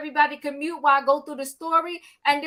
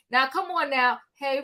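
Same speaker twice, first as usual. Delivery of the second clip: ordinary talk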